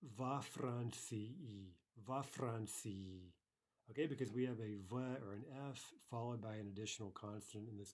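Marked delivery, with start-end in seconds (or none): 0.0-3.3 "Во" said slowly and clearly as vo; teacher slowly models "Во Франции". Shown as a demonstration. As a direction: stretch "Во Франции" and land on Во. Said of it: "that's gonna be vo".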